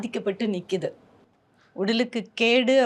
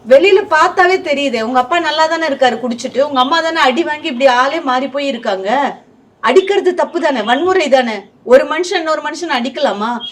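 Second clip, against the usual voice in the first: heated scolding; 5 semitones higher, 10 dB louder than usual. Real, not acted. Host loudly scolding the fifteen-year-old son on air. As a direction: raise your voice and scold him hard.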